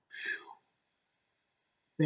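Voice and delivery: long, soft blown-out phew; relieved exhale. Real, not acted. Reviewer spent after the recap, long exhale. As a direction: blow out a long unvoiced breath.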